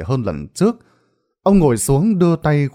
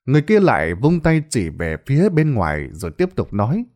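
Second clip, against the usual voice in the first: usual speaking voice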